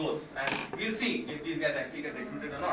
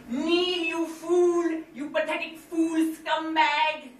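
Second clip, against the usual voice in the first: high, loud cartoon villain scolding; Skeletor voice; 9 semitones higher, 6 dB louder, much slower than usual. Villain scolds henchman in high mocking voice. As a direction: bark orders like a sneering cartoon villain.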